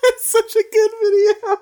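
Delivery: talking high through laughter; real laughter about a video; high laughing. Real, not acted.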